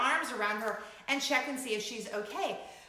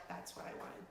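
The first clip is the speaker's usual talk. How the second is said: whisper; 5 semitones lower, 18 dB quieter, faster than usual. Real, not acted. Breathless whispered aside.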